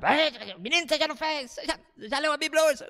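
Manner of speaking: high, nagging reproach; bossy scolding. Shown as a demonstration. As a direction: boss the kid around. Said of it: "Não é mandar".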